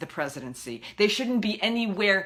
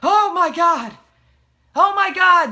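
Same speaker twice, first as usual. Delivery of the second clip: hammed-up gasp of fake surprise, higher and louder; oh-my-God sarcasm, overplayed; mock shock; 8 semitones higher, 7 dB louder than usual. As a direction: pretend to be scandalised, sarcastically. Sarcastic fake shock at old news.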